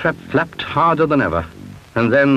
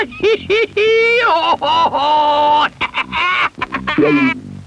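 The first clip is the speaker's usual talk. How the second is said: high witch cackle; long wicked cackle; evil cackle. A narrator's reading. Witch cackles after spell.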